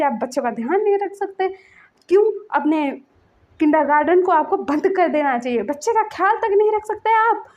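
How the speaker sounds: retold furious parent; angry. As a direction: tear into the teacher, near tears. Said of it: "बहुत गुस्सा कर रहा था".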